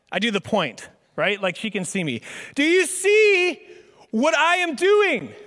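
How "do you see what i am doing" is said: slow, high, loud and pointed; hammed-up huffy husband; exasperated. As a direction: drag every word out as if it should be obvious. Acted annoyed reply to wife.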